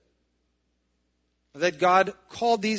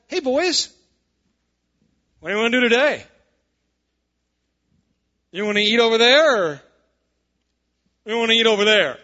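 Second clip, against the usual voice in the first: mock indulgent shepherd; 6 semitones higher, 8 dB louder than usual. Shepherd asking his sheep where they'd like to eat, mock indulgent calling. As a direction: holler high across a field as a hammed-up shepherd. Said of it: "The shepherd is like".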